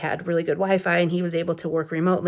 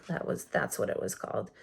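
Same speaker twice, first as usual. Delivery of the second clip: muttered aside; 7 semitones lower, 12 dB quieter than usual. Real, not acted. Muttered aside after recalling name.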